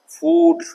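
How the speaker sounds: फूड with a long, drawn ऊ, called out high and loud; long oo demo. Shown as a demonstration. Teacher calls out फूड to students, stretching the long ऊ. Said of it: "long ऊ".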